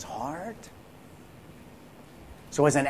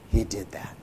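awed whisper; 4 semitones lower, 5 dB quieter than usual. Real, not acted. Awed aside after scripture.

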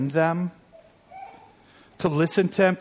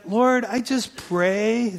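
slow prayer; 5 semitones higher, much slower than usual. Retold or imitated. Retold prayer to the Lord.

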